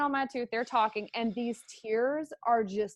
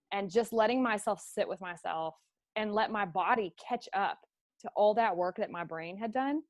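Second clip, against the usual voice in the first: normal talking voice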